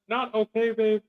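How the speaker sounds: high, put-on sweet voice; mock sweet spouse. Mocking what a caring spouse would say, high and sweet. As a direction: coo it high like a doting partner. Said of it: "and it's like".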